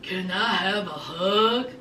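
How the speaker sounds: low, soft, slow pleading voice; meek low ask, slowed; imitating Sean. Sean asks Destiny for a hug, low meek voice. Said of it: "Sean said".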